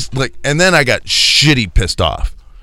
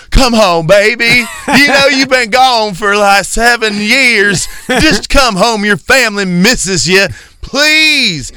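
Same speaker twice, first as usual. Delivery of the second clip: much higher, louder, overdone begging; mock pleading wife; 12 semitones higher, 4 dB louder, slower than usual. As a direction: ham up the wife begging him to come home. Mocking wife pleading at club.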